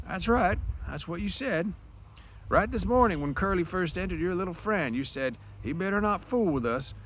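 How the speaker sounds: put-on high, thin old man's voice. Candy backing up George, old man voice. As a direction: speak as a frail old ranch hand.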